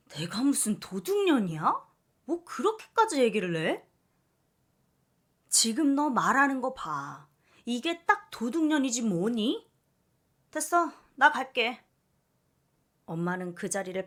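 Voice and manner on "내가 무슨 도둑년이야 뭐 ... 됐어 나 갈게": loud, higher, offended mother; mother snapping back before storming out; huffy loud protest; indignant outburst. Acted.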